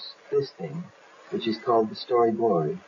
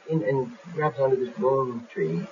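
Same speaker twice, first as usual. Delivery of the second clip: normal talking voice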